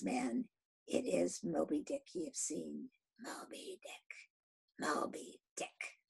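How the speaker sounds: whispered, slow naming of the whale; hushed dread. Acted. Captain whispers the dreaded whale's name. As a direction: breathe the name with awe.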